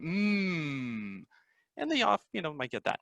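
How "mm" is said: soft hummed "Mm"; lip sound mm. Shown as a demonstration. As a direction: hum "Mm" gently. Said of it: "it's the lip sound".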